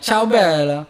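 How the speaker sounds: lightly put-on Italian accent; a touch of lilting Italian accent; imitated Italian accent. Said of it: "an accent like that".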